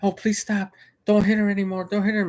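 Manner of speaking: put-on high pleading voice of an older woman; pleading older lady. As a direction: beg him to stop from the back seats. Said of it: "an older lady from the back said".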